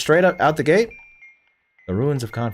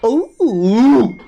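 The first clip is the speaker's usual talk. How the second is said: much higher, drawn-out teasing ooh; 11 semitones higher, 4 dB louder than usual. Real, not acted. Streamer reacting to a cheeky chat line, teasing. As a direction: tease the viewer playfully.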